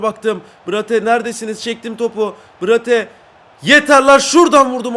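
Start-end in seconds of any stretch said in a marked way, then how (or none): 3.6-5.0 loud, high outburst; frustrated shout. Real, not acted. Gamer taking a shot after waiting for teammates, fed-up shout. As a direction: burst out loudly, fed up.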